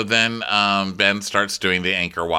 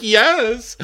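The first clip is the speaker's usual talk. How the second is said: high, loud whimpering mimicry; hammed-up weepy answer; mock tearful; 14 semitones higher, 5 dB louder than usual. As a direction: squeak it out as if crying. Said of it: "Camille's crying".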